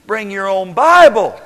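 slow, loud punchline on Bible. Acted. Preacher to church, punchline on Bible.

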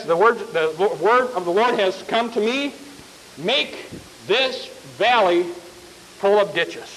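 a prophet quietly handing down God's word; solemn prophet. Acted. Prophet telling the kings God's word, low and soft.

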